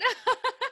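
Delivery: short, high burst of laughter; bright-pitched happy giggle; delighted laugh. Real, not acted. Host delighted by guest's answer.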